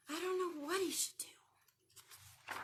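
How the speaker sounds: slow, puzzled whisper. Acted. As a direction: wonder aloud in a hush. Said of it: "reading to children".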